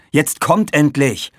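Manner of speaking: loud, high, slow exasperated order; annoyed call. A narrator's reading. Aaron urges his squabbling friends to come, annoyed call. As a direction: hurry them along, drawn out with impatience. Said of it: "rief Aaron genervt".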